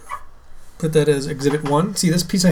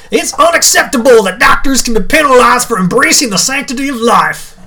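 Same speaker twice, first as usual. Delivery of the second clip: loud, higher Southern senator proclamation; southern accent; 7 semitones higher, 6 dB louder than usual. Acted. Co-host reading a senator's quote, mock Southern accent. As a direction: declaim it like a Southern politician, full voice. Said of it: "say it with a southern accent".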